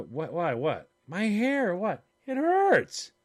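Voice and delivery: mock bewildered, climbing to a high yelp at the end; confused, rising to a squeal. Retelling a baffled scalp complaint, escalating to a high squeal. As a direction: play it baffled then shriek the hurt. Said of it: "You go".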